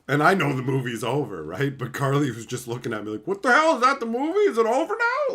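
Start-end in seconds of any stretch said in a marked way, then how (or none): 3.3-5.3 much higher, louder, climbing confused questions; baffled girlfriend. Retold or imitated. Carly staring at him when the movie just stops, baffled. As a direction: sound totally lost that it ended.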